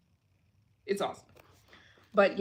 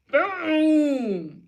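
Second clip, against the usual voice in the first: excited boom; 9 semitones higher, 8 dB louder than usual. Real, not acted. Celebrating donation total.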